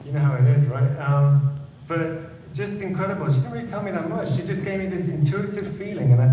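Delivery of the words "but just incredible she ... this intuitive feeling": moved. Real, not acted. Moved recalling his nan.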